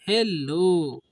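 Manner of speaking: Hello called out slowly like answering someone; drawn-out hello to get attention; calling out hello. Shown as a demonstration. Teacher shows children how to call hello, slow call. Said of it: "बोलते नहीं hello, क्या बोलते हैं?".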